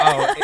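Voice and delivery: squealing high laugh. Real, not acted. Laughs at pill joke.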